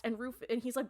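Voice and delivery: ordinary talk, nothing marked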